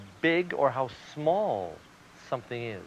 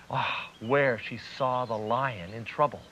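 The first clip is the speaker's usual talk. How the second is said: ordinary delivery, nothing marked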